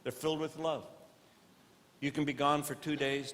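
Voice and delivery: ordinary talk, nothing marked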